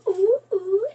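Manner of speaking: high excited oohs; high thrilled oohs; excited cooing. Real, not acted. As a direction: coo with rising wonder. Excited as the bulb lights.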